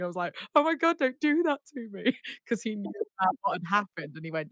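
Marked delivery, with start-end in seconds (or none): 0.6-2.2 high-pitched mock wail of relief; retold tearful plea. Singer to the friend who pranked her, tearful plea. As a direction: plead in a squeaky, half-laughing wail. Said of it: "I just started crying".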